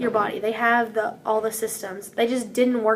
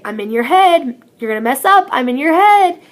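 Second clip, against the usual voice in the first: taunting; 3 semitones higher, 9 dB louder than usual. Retold or imitated. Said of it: "mess with each other when we were serving and say".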